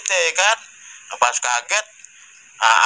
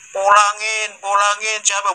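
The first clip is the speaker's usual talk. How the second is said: calling out; 6 semitones higher, 3 dB louder, slower than usual. Retold or imitated. Villagers searching for lost child.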